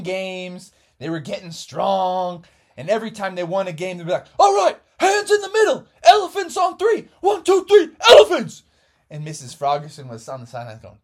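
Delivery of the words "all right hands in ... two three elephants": high, loud huddle chant; rally the team with a big shouted count; team cheer shout. Acted. Kids in the huddle shouting their team chant, loud.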